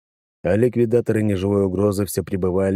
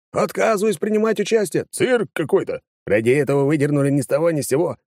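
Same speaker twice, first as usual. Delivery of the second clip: higher, clamorous indignant protests; a hall of outraged deputies; indignant outcry; 10 semitones higher than usual. Acted. Возмущённые выкрики депутатов. (возмущённые выкрики)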